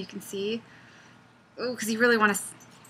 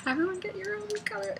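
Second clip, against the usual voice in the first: party host; 12 semitones higher than usual. Pretend. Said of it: "I'm in a party and be like".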